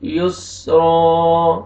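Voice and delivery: drawn-out chanted verse; slow Quran recitation. Shown as a demonstration. Teacher recites the next verse slowly. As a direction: intone each word like a reciter.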